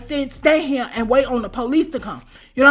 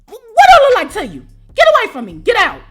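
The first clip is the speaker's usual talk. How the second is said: high, loud indignant yell; outraged shout; 9 semitones higher, 7 dB louder than usual. Retold or imitated. Telling the roommate to get out, indignant shout. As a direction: throw her out in disbelief.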